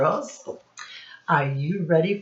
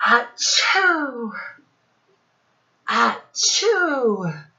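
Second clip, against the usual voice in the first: high, hammed-up sneeze; mock sneeze; 7 semitones higher, 4 dB louder, much slower than usual. Shown as a demonstration. Teacher modelling a sneeze for the class. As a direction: fake a big sneeze.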